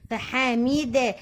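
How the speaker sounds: "فحميدة" drawn out slowly, syllable by syllable; slow spelling out. Shown as a demonstration. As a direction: sound out "فحميدة" for a beginner. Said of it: "هتفتحي له حرف وتكسروا له حرف".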